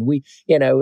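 ordinary delivery

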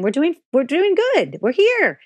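put-on a child firmly cheering her mom up; daughter's emphatic reassurance. Daughter reminding mom they're okay, high and insistent. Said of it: "She was like".

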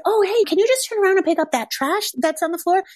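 a boss keeping it light while asking for trash pickup; sweet request. Retold or imitated. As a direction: ask her kindly with the pitch way up. Boss asks employee nicely.

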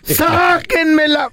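high, slowly stretched plea; mock desperate cry. Storyteller replays woman's plea for help, mock desperate cry. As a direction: imitate a trapped woman wailing. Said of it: "los gritos de desesperación".

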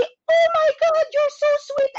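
high, loud "Oh my God"; gushing mock delight. Nurse mimicking Americans thanking for a cookie, high loud gushing squeal.

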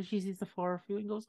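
ordinary talk

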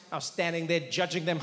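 ordinary talk

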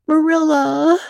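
put-on a contrite girl calling downstairs; plaintive call. A narrator's reading. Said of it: "a plaintive voice calling".

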